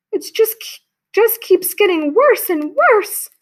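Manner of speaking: high, slow, close to tears; despairing mother. Acted. Mother fearing for Camilla, near tears. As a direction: let her voice crack with worry.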